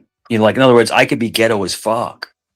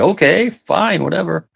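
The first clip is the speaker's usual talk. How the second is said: dismissive; 7 semitones higher than usual. Retold or imitated. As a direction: brush it off with a sigh. Dismissive reply to a girl.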